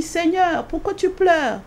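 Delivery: pleading question. Retold or imitated. She asks the Lord again.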